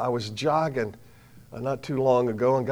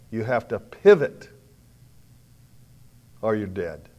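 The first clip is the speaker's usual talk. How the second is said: ordinary talk, nothing marked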